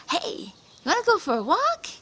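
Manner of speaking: high, loud, sugary invitation; sweet trickster invite. Acted. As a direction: bait the deer cheerfully. Spider invites the deer.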